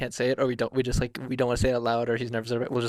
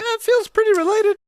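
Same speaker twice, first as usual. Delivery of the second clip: very high, louder mock protest; mock-skeptical retort; 6 dB louder than usual. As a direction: squeak back in exaggerated disbelief. Retold pushback to store employee.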